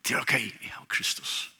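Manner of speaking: soft whisper, close and personal; whispered assurance. Real, not acted. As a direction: breathe it as a private comfort.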